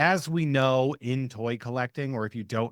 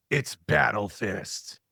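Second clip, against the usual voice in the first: slow, deliberate mock announcing; drawn-out mock announcement; 2 semitones lower, much slower than usual. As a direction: stretch out the name in a slow acted quote. Acted announcement of renamed character.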